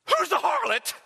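high-pitched imitation of a puzzled churchgoer. Imagined churchgoer wondering about the preacher's topic, high puzzled voice. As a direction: voice the burning question, pitch leaping up.

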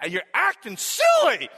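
mock-exasperated coach getting on a kid; scolding coach. Football coach telling off a young player, scolding loudly. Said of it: "I was getting all of 'em".

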